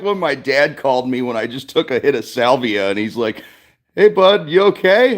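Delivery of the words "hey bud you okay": much higher, louder cheery dad; mock dad. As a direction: sing out like a concerned father. Dad calls during salvia trip.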